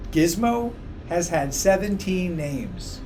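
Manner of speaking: ordinary delivery, nothing marked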